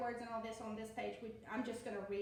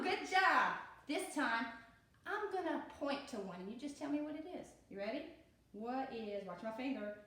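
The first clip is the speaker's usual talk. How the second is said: ordinary talk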